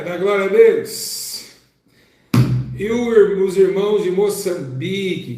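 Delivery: loud, drawn-out call to praise; rally the congregation, slow and big; preaching shout-out. Acted. Calling out churches to praise.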